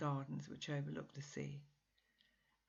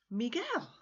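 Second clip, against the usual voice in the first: higher, louder surprised cry of his name; exclaimed; 8 semitones higher, 4 dB louder than usual. Acted. Rosie greets Miguel at her door, exclaiming. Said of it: "I exclaimed".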